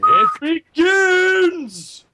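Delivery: very slow, loud, high wail of doom; hammed-up apocalyptic cry; doom-laden. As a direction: drag it out like a horror trailer. Dramatic doom line.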